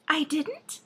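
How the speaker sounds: high, surprised princess voice; a startled storybook princess; cartoon princess.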